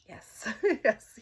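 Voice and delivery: loud delighted laugh through the yes. Real, not acted. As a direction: burst out laughing as you say yes. Delighted at emphatic answer.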